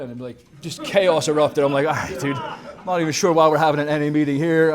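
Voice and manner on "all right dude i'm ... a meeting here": louder, baffled complaint; mock fed-up speaker at a rowdy meeting; exasperated. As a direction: throw up your hands at the chaos. Chaos erupting at the meeting.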